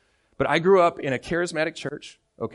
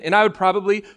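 ordinary delivery

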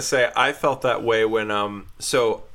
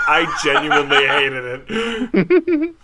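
put-on slow, laughing, louder girlfriend's verdict; 4 semitones higher, 6 dB louder, slower than usual. Quoting the girlfriend after she watched the whole movie, slow and laughing. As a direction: drag it out through a laugh, mock disgust. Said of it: "she was like".

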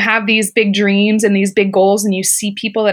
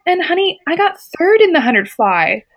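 high, lightly voiced consoling mentor; a hint of a sweet older star saying honey; higher, kindly imitated storyteller; Summer Sanders; 10 semitones higher than usual. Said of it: "She's like".

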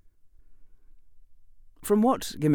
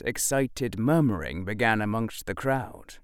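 ordinary delivery

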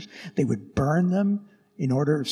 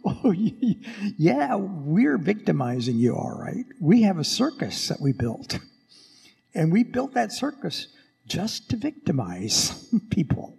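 mocking, laughing through the words; laughing emperor; 5 semitones higher than usual. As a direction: sneer at them with a laugh. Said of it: "he would just begin to laugh".

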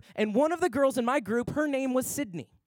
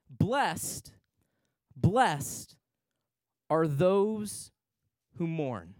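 slow, solemn reading with long pauses; scripture reading; 5 semitones lower, much slower than usual. Acted. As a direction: read it like holy words.

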